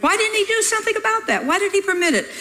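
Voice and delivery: high-pitched mock complaint; complaining thought. Preacher voicing his thoughts about Jesus, high-pitched protest. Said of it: "Jesus knew what I was thinking".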